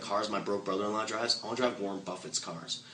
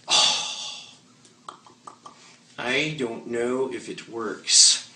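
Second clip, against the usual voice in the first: slow, louder hesitant fumble; unsure newbie; 6 dB louder, much slower than usual. Retold or imitated. New recruit answering Bill, in a slow doubtful fumble. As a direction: stammer like you doubt it yourself. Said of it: "you're gonna go".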